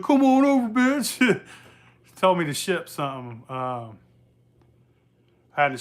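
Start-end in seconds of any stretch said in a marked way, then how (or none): 0.0-1.4 high, loud taunting dare from the friend; mock challenge. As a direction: toss out the dare in a joking tough voice. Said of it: "He's like".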